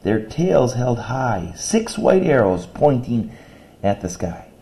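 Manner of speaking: put-on lilting read-aloud of a children's poem. Shown as a demonstration. Teacher reading a poem to her class, rhythmic. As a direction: read it with a bouncing poetic rhythm.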